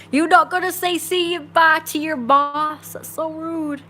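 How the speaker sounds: high, hammed-up outrage; mock indignant. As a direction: act hugely offended at being snubbed, pitched high. Mock offence at being snubbed.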